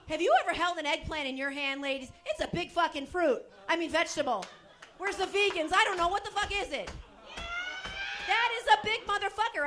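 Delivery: indignant comic rant; loud rant. Real, not acted. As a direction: shout it to the ladies. Ranting to ladies.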